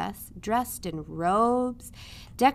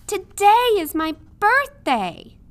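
much higher and louder, slow hopeful call; 11 semitones higher, 11 dB louder than usual. A narrator's reading. Midge calling out her birthday to the passing princess. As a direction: call across the distance to the royals. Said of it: "Midge called out".

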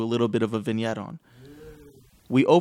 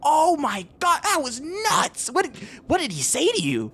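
high-pitched tiny creature voice; excited little kobold; 12 semitones higher than usual. Acted. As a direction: squeak it out in amazed excitement. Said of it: "little tiny blue kobold".

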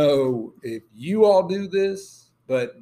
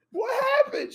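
high confused call. Retold or imitated. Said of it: "I go".

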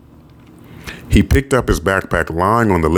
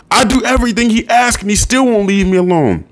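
put-on much higher, whiny kid shrieking; imitated child shriek. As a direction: act out a boy protesting shrilly. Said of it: "Chiron would shriek".

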